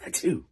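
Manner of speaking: high, sharp mock achoo; imitated sneeze. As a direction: act out a quick sneeze. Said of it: "he sneezes on the baby".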